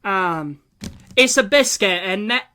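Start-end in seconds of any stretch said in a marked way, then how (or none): 1.2-2.4 lightly mimicked British accent, raised in pitch. Imitating British people reacting to American food, light British accent. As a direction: put on a bit of a British accent. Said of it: "British people reacting to American food".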